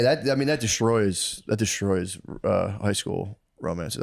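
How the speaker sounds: ordinary talk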